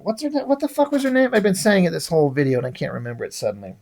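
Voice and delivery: muttering to himself, fast and quiet; a hushed aside to himself while he can't remember the name. Real, not acted. A reviewer mumbling to himself over a forgotten name, quick and quiet.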